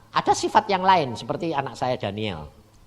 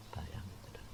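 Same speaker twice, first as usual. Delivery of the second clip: whispered aside; 7 semitones lower, 20 dB quieter than usual. Real, not acted. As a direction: lean in and whisper to the listeners. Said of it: "whispered aside to congregation". (bisikan kepada jemaat)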